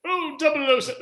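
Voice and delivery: high mock-British exclamation; British accent. Host restarts the gadget chief's line for the panel, British accent. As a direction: pitch it up in a plummy British accent. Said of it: "that's a British accent".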